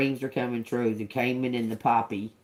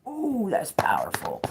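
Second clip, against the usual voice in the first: delighted squeal; 10 semitones higher, 4 dB louder than usual. Real, not acted. Reacting to card with delight.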